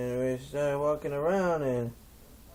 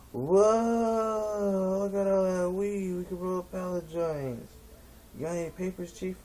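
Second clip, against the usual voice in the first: amazed stoner; 7 semitones higher than usual. Retold or imitated. Stoner sees the weed pit, high and awed. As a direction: gasp in stoned wonder.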